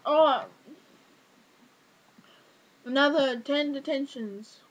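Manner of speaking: loud drawn-out "Oh", then slow imitation of a teacher; mock stern teacher. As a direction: hand down punishment, hammed up.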